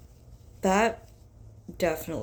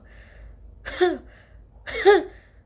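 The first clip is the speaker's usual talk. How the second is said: high loud laugh; 14 semitones higher, 11 dB louder than usual. Real, not acted. Laughs after sneeze.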